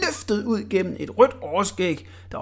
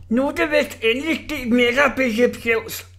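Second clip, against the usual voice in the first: higher, hoarse teasing bully; hoarse mocking jeer at the narrator; Orla's hoarse voice; 7 semitones higher, 4 dB louder than usual. A narrator's reading. Orla taunts the boy, hoarse and higher. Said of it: "med sin hæse stemme".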